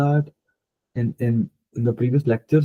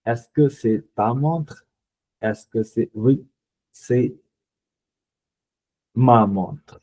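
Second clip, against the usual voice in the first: slow model sentences; much slower than usual. Shown as a demonstration. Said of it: "आपको चीज याद करनी है ये".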